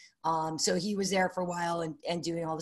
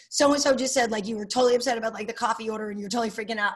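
rushed retelling; 3 semitones higher, faster than usual. Retold or imitated. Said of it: "She's like".

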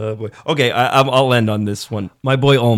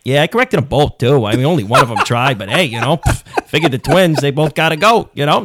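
hammed-up Staten Island wiseguy excuses; comic Staten Island tough guy; Staten Island accent; 2 semitones higher than usual. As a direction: shrug it off in broad Staten Island talk. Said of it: "like, a Staten Island accent".

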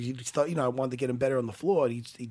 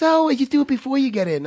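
put-on girl's helpful voice; 9 semitones higher, 7 dB louder than usual. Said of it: "She goes".

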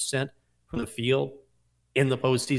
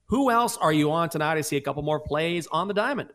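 usual speaking voice

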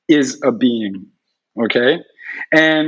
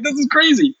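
excited disbelief; 12 semitones higher, faster than usual. Retold or imitated. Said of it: "I'm like".

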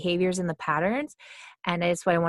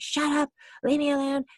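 much higher, mock snappish outburst; angry; 9 semitones higher than usual. Acting out brushing somebody off when they come up, mock angry. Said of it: "being really angry all the time".

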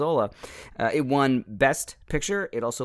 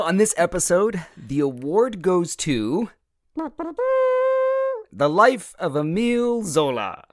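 announcer voice; 7 semitones higher, 6 dB louder, slower than usual. Acted. Dramatic announcement of the winner.

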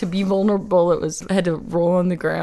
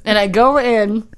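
ordinary delivery

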